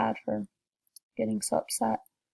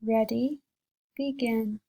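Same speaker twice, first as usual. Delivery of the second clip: ordinary delivery, nothing marked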